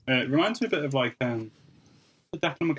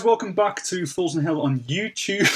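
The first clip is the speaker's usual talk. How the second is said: bright, upbeat channel greeting; YouTuber intro voice; 6 semitones higher than usual. Acted. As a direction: hype up the viewers like a YouTuber. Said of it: "I default to a very standard YouTuber voice there".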